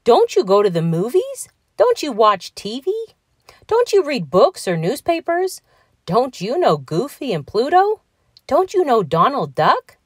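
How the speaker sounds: high, swooping questions piling up; incredulous Donald Duck. A narrator's reading. Donald is amazed Patrick doesn't know him, incredulous. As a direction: quiz him in offended disbelief.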